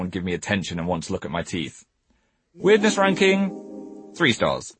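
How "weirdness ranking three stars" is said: announcer. Acted. Recurring ranking announcement.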